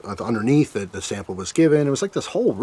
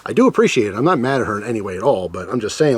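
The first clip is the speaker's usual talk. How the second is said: normal talking voice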